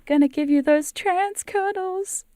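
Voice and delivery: high, slow, put-on cutesy voice; silly cuddle voice. To wife in the car, high slow cutesy voice. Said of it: "I was talking to my wife and I was like".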